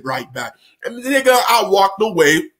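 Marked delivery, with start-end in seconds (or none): 0.0-0.5 low, soft echo; low, soft, sly echo; quiet. Retold or imitated. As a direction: mutter it back before slipping off. Quiet mocking reply.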